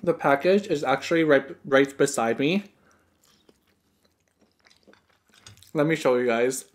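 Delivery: eating between words; talking while chewing. Real, not acted. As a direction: mouth partly full of food. Seen 3: Bites food at -1, talks with chewing mouth, bites again at 2.6.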